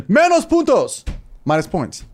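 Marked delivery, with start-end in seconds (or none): 0.0-1.0 high, loud, slow Spanish teacher verdict; strict teacher snap. Retold or imitated. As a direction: fire it out with no hesitation, "Menos puntos" stretched out high. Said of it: "she would just fire it out, no hesitation".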